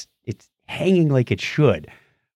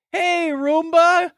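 much higher, slow, doting voice; cooing at the Roomba; 14 semitones higher, slower than usual. Retold or imitated. Work-from-home guy doting on his Roomba in a high voice. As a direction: greet the vacuum like a beloved pet. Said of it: "how to tell the guy who works from home".

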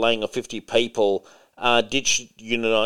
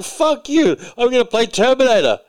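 high, loud mock tough-guy outburst; mock defiant Dinklage; 11 semitones higher, 5 dB louder than usual. Host voicing Dinklage as defiant, in a high loud mock shout. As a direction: throw it out like a cocky little tough guy.

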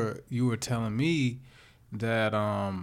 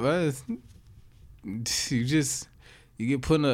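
usual speaking voice

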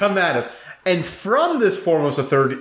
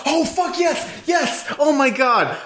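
much higher excited yelling; excited shout; 11 semitones higher, slower than usual. Real, not acted. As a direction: burst out in excited yelps. Excited reaction to Hobbs and Shaw.

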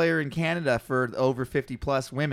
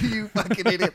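higher-pitched mock jeer through laughter; retold laughing jeer; 7 semitones higher than usual. Old ladies in a car laughing at him, mock jeer. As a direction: imitate onlookers laughing at you. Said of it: "they're just laughing at me".

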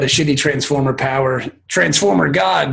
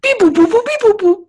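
high beeping robot noises; toy robot voice. Pretend. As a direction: beep like a little toy robot.

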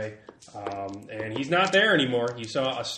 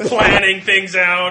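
laughing; 6 semitones higher, 11 dB louder, much slower than usual. Real, not acted. Laughing at villain's scheme.

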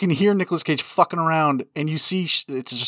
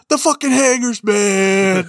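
lightly raised, louder crazed reply; mock Cage voice; 6 semitones higher, 5 dB louder, slower than usual. Co-host imitates Cage answering Diane, lightly hammed-up. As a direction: toss it off like a tweaked-out madman.

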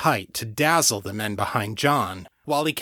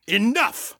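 high sharp cutting command; snapped; 11 semitones higher than usual. A narrator's reading. John cutting off the demon's taunts, sharp. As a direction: cut her off sharply. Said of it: "John snapped".

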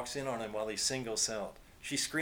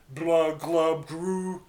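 gurgling, much louder blubbing creature; gurgling fish man croak; 4 semitones higher, 9 dB louder, much slower than usual. A narrator's reading. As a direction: gurgle it through a floppy mouth. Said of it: "slipper mouth".

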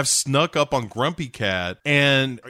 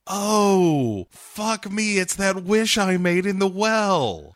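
Crystal dismayed; 8 semitones higher, slower than usual. Retold or imitated. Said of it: "Crystal is immediately like".

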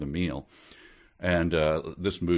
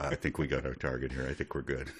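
low, hushed agent murmur; a Secret Service agent quietly reporting in; into the sleeve; 6 semitones lower, 8 dB quieter, faster than usual. Retold or imitated. Agent reports the target into his sleeve, hushed. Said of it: "Everyone whispering into their sleeve".

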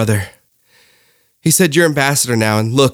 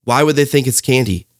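ordinary delivery

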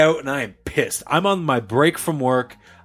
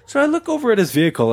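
usual speaking voice

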